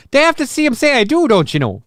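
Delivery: high, hammed-up Minnesotan lilt; Minnesotan accent. Host teasing co-host about the slip, mock Minnesotan accent. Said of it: "had a little bit of Minnesotan".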